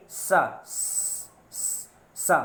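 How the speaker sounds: "स-" as a long unvoiced hiss between the teeth, then "सा"; hissed dental s. Shown as a demonstration. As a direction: let "स-" hiss against the teeth before "सा". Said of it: "दांतों से आकर के टकराएगी". (दांतों से टकराती सिसकारी "स-", फिर "सा")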